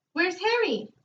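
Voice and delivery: high squawky parrot call. Acted. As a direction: squawk it out like a talking bird. Parrot speaks in story.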